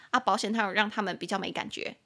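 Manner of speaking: usual speaking voice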